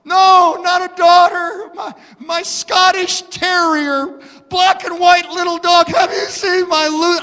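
high, loud, desperate plea; imitating a frantic woman. Woman searching for her lost dog, pleading high and loud. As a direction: cry out as the panicked owner. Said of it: "she is sobbing".

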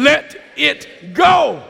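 loud, high, slow rallying shout; big, drawn-out enthusiastic shout; with enthusiasm. Shown as a demonstration. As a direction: belt out each word to rouse the congregation. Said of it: "Say it with me with some enthusiasm".

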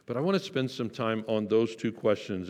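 ordinary talk

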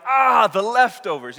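loud, high, drawn-out mock panic; mock freak-out. Disciples panicking over forgotten bread, mock freak-out. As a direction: wail it as an exaggerated freak-out. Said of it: "They're freaking out because they're like".

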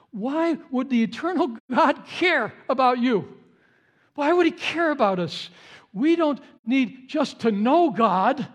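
full-force preaching. Acted. Preacher urging the congregation, in full-force preaching. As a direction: preach it out to the whole room.

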